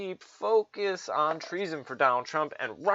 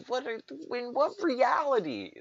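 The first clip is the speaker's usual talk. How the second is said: incredulous; 11 semitones higher than usual. Real, not acted. Incredulous outburst.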